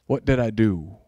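quiet, low mock dismay; a touch of startled guilt. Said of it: "You don't say".

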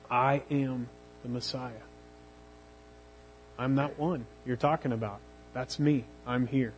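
Jesus quietly revealing himself to the woman, soft and gentle. A narrator's reading. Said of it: "he says softly and gently but unmistakably".

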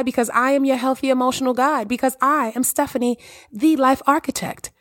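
bright, lifted host voice greeting listeners; podcast host intro. Acted. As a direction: welcome the audience like a radio host. Podcast host opening address.

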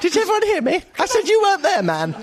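mocking imitation. Co-host mimicking the other's shout, high mocking. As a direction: parody your co-host's gleeful shout. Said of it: "Russell will shout".

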